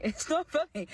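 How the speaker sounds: real laughter through the words; laughing. Real, not acted. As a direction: protest while cracking up.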